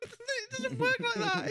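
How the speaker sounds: high, slow words through laughter; half-laughing at the son's excuse. Real, not acted. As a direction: laugh it out in disbelief.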